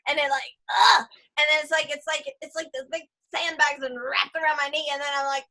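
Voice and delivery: ordinary delivery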